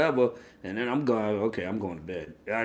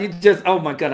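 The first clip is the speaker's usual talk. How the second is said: normal talking voice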